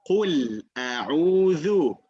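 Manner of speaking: Qul a'udhu said slowly and carefully; slow model recitation. Shown as a demonstration. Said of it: "So you say".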